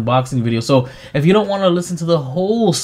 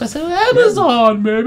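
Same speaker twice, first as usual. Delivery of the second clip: excited; 14 semitones higher than usual. Pretend. Hyped boast about Amazon.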